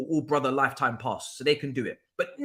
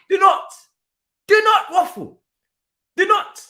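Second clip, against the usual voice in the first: shouting; 9 dB louder than usual. Real, not acted. Ranting at rival fans.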